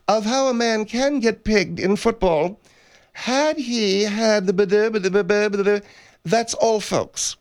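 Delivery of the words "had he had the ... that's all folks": hint of a stuttering cartoon sign-off; stuttered catchphrase, slowing at the start; Porky Pig. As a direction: lightly imitate a stammering cartoon pig. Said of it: "You've never heard of Porky Pig?".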